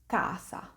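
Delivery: casa as "KAH-sa", hard k; Italian word demo. Shown as a demonstration. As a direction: say casa with a long open first A. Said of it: "An example would be in the word".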